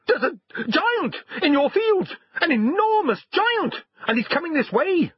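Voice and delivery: very high, squeaky, urgent report; alarmed talking dog. A narrator's reading. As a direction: raise the alarm as an excited little dog. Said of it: "said the dog".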